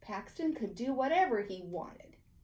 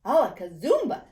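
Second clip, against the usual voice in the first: loud magic incantation; 10 dB louder than usual. A narrator's reading. Boy casting his magic word, loud and proud. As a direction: shout the magic word with a flourish.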